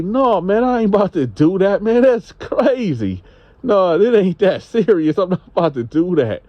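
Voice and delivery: high-pitched, protesting disbelief; incredulous refusal. Retold or imitated. Homeless man refusing the woman's revenge plan, incredulous protest. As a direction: protest it high, like the idea is crazy.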